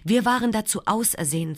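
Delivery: ordinary delivery, nothing marked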